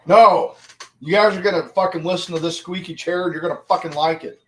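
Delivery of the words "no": loud refusal. Real, not acted. Refusing viewers' oil request.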